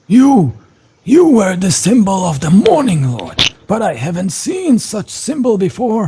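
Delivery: put-on high, questioning old priest; wary priest. Priest questioning the stranger's unfamiliar symbol. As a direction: doubt the stranger's holy symbol. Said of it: "he kinda looks at you".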